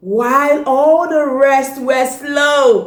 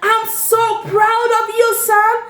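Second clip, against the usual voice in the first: raised, ringing but a touch calmer; proud call; 6 semitones higher than usual. Acted. Dad calling out to his son again, raised proud call. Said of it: "Dad shouted out".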